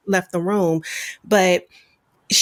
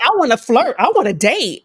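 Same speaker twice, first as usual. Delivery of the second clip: emphatic protest; 4 semitones higher, 5 dB louder than usual. Retold or imitated. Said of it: "she was like".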